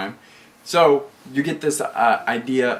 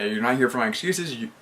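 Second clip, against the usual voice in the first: normal talking voice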